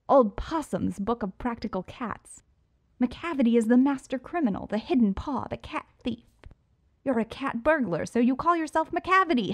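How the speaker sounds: high, cocky villain voice; Riddler voice. Acted. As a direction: play the smug showman. Riddler's delighted rant.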